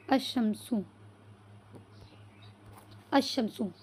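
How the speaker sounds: "अशमसु" with no 'l', straight into 'sh'; lam silent. Shown as a demonstration. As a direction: say "अशमसु" as ash-shamsu, the 'sh' doubled. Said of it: "इसमें लाम का sound नहीं आएगा तनहा में". ("अशमसु" लाम के बिना, शीन पर ज़ोर से मिलाकर)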